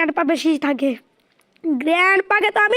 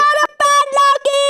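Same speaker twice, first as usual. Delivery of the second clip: excited surprise; 7 semitones higher, 3 dB louder, slower than usual. Real, not acted. Finds the padlock key.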